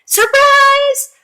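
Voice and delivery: high, bright burst of Surprise; spring the surprise on the kids; surprise reveal. Acted. Teacher revealing the ladybug to children, big surprised burst.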